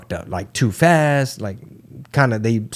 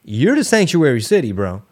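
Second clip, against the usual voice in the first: usual speaking voice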